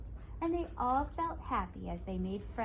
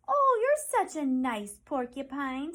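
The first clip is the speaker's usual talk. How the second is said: higher, louder and slow, full of thanks; old woman thanking Penny; grateful old woman; 5 semitones higher, 9 dB louder, slower than usual. Acted. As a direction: praise the porcupine fondly. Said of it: "said the woman".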